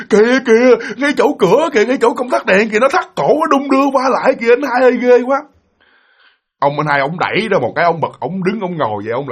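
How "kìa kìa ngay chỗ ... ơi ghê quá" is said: high, urgent frightened cry; panicked pointing out. Retold or imitated. Scared sibling clinging to big brother, high panicked cry. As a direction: plead for help in acted terror. Terrified child points out the ghost.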